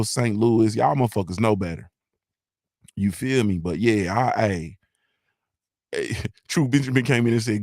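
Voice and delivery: normal talking voice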